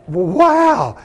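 high, awestruck exclamation; retold amazement. Daughter reacts to her father's guarantee, amazed in a high voice. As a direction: let the wonder lift the pitch.

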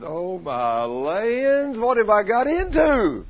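slow wail climbing high and loud; comic dismay. Acted. Husband facing his wailing wife, exaggerated dismay. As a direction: draw it out in mock despair.

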